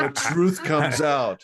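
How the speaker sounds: slow, high melodramatic reveal; mustache twirling villain. Pretend. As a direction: savor it like a stage villain. Repeats the gotcha dramatically.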